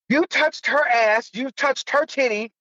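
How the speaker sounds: drawn-out imitation of the cops' accusation; accusing cop. Cops accusing him at his house, slow and high.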